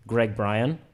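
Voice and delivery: ordinary talk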